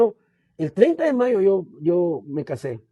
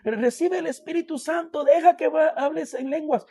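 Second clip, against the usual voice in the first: higher-pitched mock call from across the room; calling out from afar; 7 semitones higher than usual. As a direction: call it out high, like a distant voice urging him on. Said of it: "alguien allá a lo lejos gritaba".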